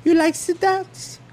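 put-on high, slow Cuban-accented wife voice; Cuban wife impression. Husband imitating Cuban wife, high with Cuban accent. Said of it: "whenever I do an impression of her".

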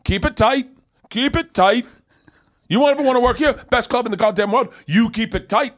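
hammed-up bossy veteran comic; pushy warning. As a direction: imitate a cocky comic barking orders at the new guy. Bully comic lecturing newcomer.

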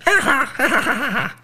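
high mocking parrot laugh; parrot cackle. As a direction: cackle like a gloating bird. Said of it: "the parrot's like".

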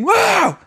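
high-pitched startled "whoa"; short imitated scream; mock falling scream. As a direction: cry out as if suddenly falling. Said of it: "and I scream".

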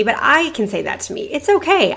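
ordinary talk

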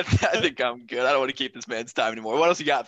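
ordinary talk, nothing marked

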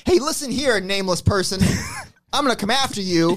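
wrestling promo. Pretend. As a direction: call out your rival like a wrestler. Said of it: "you wanna cut a promo right now?".

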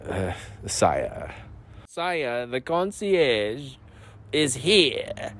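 slow, drawn-out, lightly hammed-up announcer voice; mock royal herald. Customers hail the door-holder, mock royal announcement. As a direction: stretch out concierge like a grand herald. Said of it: "Everyone's like".

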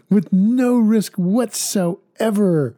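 slow, high hyped pitch; infomercial. Pretend. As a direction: oversell like a TV pitchman. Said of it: "Sounds like an infomercial".

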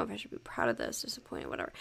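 unvoiced throwaway whisper; whispered aside. Real, not acted.

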